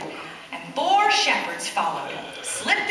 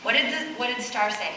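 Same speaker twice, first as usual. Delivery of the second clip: usual speaking voice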